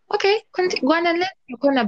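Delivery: high-pitched, hurried brush-off; dismissive. Real, not acted. Telling someone to go unlearn in their corner, dismissive. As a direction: wave them off in a high, quick voice. Waving off the other speaker's excuse.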